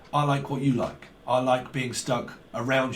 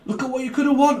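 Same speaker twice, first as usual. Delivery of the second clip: high, loud game-show host impression; Jim Bowen; 9 semitones higher, 4 dB louder than usual. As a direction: rub in the prize they missed. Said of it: "Like Jim Bowen".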